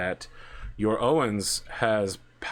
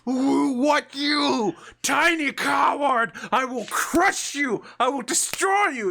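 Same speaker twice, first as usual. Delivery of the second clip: high, loud, slow furious roar; barbarian rage; 6 dB louder, slower than usual. Acted. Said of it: "the furious rage of someone who".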